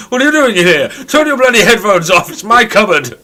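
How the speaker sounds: comic scene, a stranger squashed into her cupboard; mock outrage. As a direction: squawk in mock fury. Imagined cupboard quarrel.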